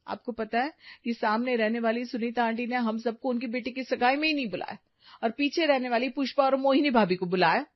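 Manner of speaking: ordinary delivery, nothing marked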